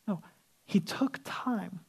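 slow soft hush; whisper. Acted. Telling the church Jesus took time, in a slow whisper. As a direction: let it drop to a slow whisper. Preacher softens for emphasis.

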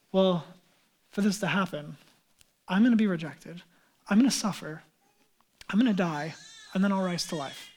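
low, soft and measured; quiet, grave foretelling. Acted. Jesus tells disciples he will die, quietly grave. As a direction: break hard news softly, pausing between statements.

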